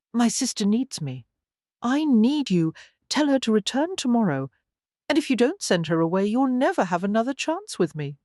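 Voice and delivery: insist, a touch imperious; spoiled lover's lightly voiced demand; demanding lady. A narrator's reading. Jilted lover demands he stay.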